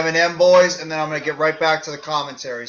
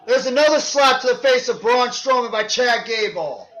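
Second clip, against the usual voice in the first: wrestling commentator; 8 semitones higher than usual. Acted. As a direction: shout the big moves like live TV. Live play-by-play of match.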